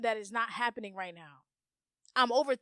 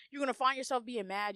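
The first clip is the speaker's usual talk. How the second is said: normal talking voice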